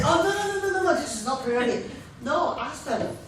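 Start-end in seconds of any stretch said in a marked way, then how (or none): 0.0-1.9 sing-song no no no, pitched way up; dismissive, jumping in too fast; mock defensive denial. Prospect rushed to deny pyramid, hammed-up sing-song no. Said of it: "Don't jump ahead and say".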